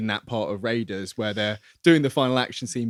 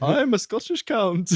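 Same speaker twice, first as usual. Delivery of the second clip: slow, much higher hammed-up Scottish accent; bad Scottish accent; 9 semitones higher than usual. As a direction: put on a clumsy Scottish accent, slowly. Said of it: "Indy doing the Scottish accent".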